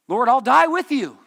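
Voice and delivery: loud, high, boastful shout; blustering vow. Retold or imitated. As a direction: bellow it like a brash fighter. Peter's bluster in Passion Week.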